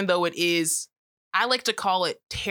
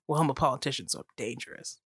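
low sultry mock voice; 4 semitones lower, 5 dB quieter than usual. Mock romance hero voice.

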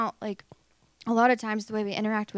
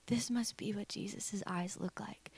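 slow, hushed whisper of wonder; soft, reverent whisper; awed inner thought; 8 dB quieter than usual. Retold or imitated. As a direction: whisper it slowly to yourself. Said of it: "I remember thinking to myself".

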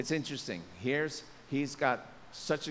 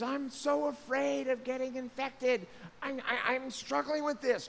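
mock fear; 10 semitones higher than usual. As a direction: act out a worried believer, a touch of fear. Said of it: "The fear is".

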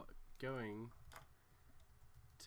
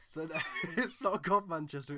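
ordinary delivery

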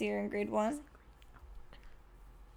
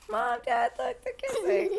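put-on excited child call; slower than usual. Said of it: "run out and be like".

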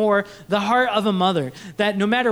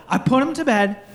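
ordinary delivery

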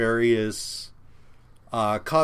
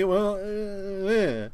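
higher, dragged-out feeble non-answer; mocking shrug; 9 semitones higher, much slower than usual. Fan mimics writers' answer to the mystery, mockingly feeble. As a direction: mimic a dopey, noncommittal mumble of an excuse.

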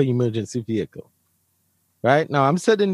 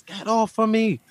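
higher-pitched mock yell of an older woman calling security; imitating a yelling lady; 7 semitones higher than usual. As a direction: play a panicked old lady crying for security. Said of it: "She yells".

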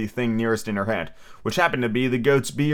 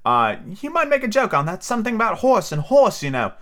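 small voice; 9 semitones higher than usual. A narrator's reading. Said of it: "An extremely small voice".

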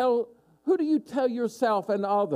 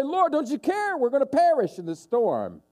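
much higher, urgent cry; panicked disciples; 8 semitones higher than usual. Retold or imitated. Frightened disciples crying out to sleeping Jesus. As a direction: plead as if about to drown.

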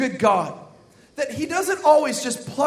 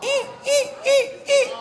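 tiny, very high squeaks; metal scraper squeak; 3 dB quieter than usual. Shown as a demonstration. Pastor imitating the scraper thing, short high squeaks. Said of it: "it says".

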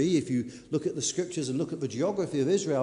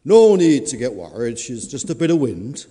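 booming reassuring farmer; 5 semitones lower, 8 dB louder than usual. Acted. Farmer reassures wife in storm.

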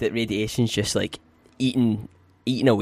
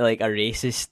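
ordinary delivery

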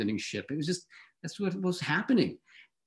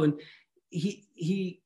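normal talking voice

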